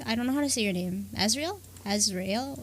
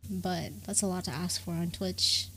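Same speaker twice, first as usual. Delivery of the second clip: whispered aside; 5 semitones lower, 7 dB quieter than usual. Real, not acted. Wry aside about Twitch.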